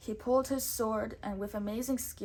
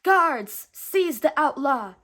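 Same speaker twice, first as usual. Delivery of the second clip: loud high commanding shout; shouting prince; 4 semitones higher, 11 dB louder, slower than usual. A narrator's reading. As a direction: bellow the order at the guards. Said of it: "shouted Prince John".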